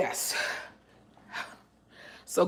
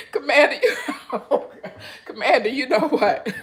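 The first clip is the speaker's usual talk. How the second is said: laughing; 7 semitones higher, 3 dB louder than usual. Real, not acted. Laughing at a viewer's comment.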